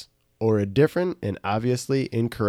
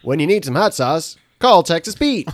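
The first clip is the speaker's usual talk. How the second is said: ad read; 6 semitones higher, 5 dB louder than usual. Pretend. Mock commercial pitch.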